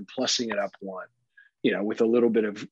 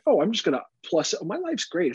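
higher-pitched mock optimism; naive would-be parent; 7 semitones higher than usual. Would-be parent imagining only the joy, mock-naive. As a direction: imitate someone blithely planning a child.

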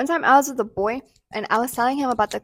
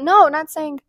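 ordinary delivery

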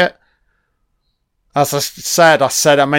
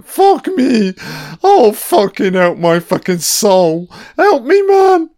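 mock panic; 7 semitones higher than usual. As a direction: pitch shooting way up into a half-joking terrified yelp. Tent shredded in horror film.

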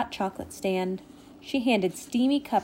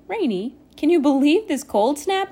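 excited, higher and louder question; 7 semitones higher, 5 dB louder than usual. A narrator's reading. Nimbus asks Rainy about the cold snap, excited. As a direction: voice lifts with excitement. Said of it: "He was so excited".

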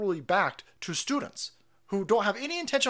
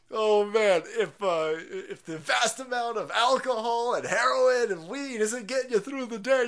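ordinary talk, nothing marked

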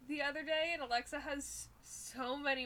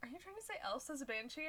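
ordinary talk, nothing marked

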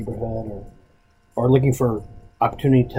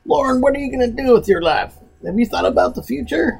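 high, loud pushy recruiter; 12 semitones higher, 4 dB louder than usual. Retold or imitated. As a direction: voice the nagging recruiter. Recruiter voiced in a story.

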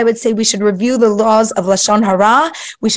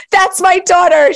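proud mom; 8 semitones higher than usual. Retold or imitated. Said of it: "She would have been like".

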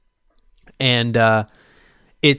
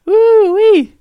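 high, loud falsetto woo-wee; excited whoop; 10 dB louder than usual. Real, not acted. Laughing at his own bro voice, high loud whoop. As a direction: let out a shrill hoot of delight. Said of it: "whoop after bro bit".

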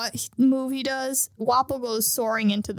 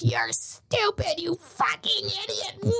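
slow, high hammed-up rant; cartoonish outburst, pitched up; mock furious scolding; 8 semitones higher, slower than usual. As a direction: play an enraged chase-off, drawn out. Acting out Kureha chasing Chopper off.

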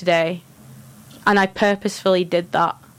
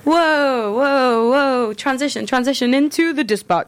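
showy announcer; 5 semitones higher, slower than usual. Pretend. Hammy segment transition.

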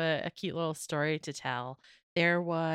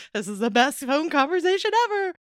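loud, high gush of delight; 8 semitones higher, 8 dB louder than usual. Retold or imitated. Agent thrilled by a funny call, high and delighted. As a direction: let retold glee burst out.

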